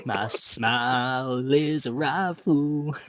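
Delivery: a slow, drawn-out recital of a song line; mock lyric recital. Quoting the song Blessing to his co-hosts, slow and half mocking.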